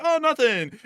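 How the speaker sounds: high innocent mock voice; a hint of hiding something; mock innocence. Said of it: "He's like".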